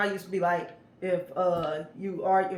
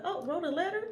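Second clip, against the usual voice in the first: surprised; 11 semitones higher, 4 dB quieter than usual. Retold or imitated. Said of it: "you'll be like".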